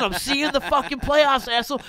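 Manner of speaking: high, loud hollered taunt; imitated yelling. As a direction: re-enact a buddy's shouted heckle. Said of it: "he starts just yelling".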